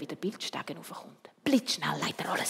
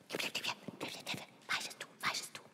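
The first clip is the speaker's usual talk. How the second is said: gossiping; 8 dB quieter, much slower than usual. Acted. Mouse kids gossiping.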